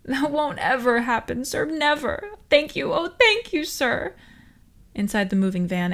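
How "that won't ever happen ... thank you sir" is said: high, loud, hammed-up gratitude; a hammed-up driver gushing thanks to the guard. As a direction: gush thanks like a ditzy fake. Fake grateful gushing.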